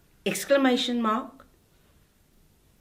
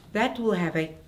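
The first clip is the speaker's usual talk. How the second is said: usual speaking voice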